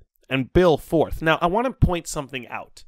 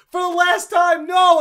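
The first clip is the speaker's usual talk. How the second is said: raised, high, loud retort; exasperated refusal; 13 semitones higher, 6 dB louder than usual. Retold or imitated. Student refusing the interrogator yet again, loudly exasperated. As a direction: shout back, fed up with being asked.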